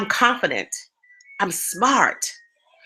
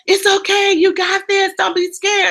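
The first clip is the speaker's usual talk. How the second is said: high, louder cheering encouragement; encouraging crowd; 9 semitones higher, 4 dB louder than usual. Retold or imitated. People on the ground calling up to her, high encouraging. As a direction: call up reassurance from far below. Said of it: "was going".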